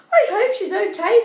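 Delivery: hope out loud with worry; worried Rapunzel. Acted. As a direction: Rapunzel fretting for her friend, high. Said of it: "said Rapunzel".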